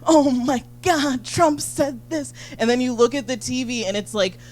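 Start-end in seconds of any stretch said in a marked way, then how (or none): 0.1-2.3 hammed-up panicked gasping; mock-agonized, dragging it out; girlfriend glued to debate. Imitating her girlfriend agonizing over the debate, slow and dramatic. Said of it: "she's just like".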